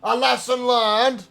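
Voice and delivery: downtrodden gambler, moved then self-pitying; sorrowful gambler. Acted. Gambler moved by old die.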